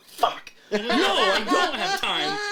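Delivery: high, slow, laughing protest; laughing refusal. Retold or imitated. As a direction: groan it out through laughter. Teller quoting his panicked refusal.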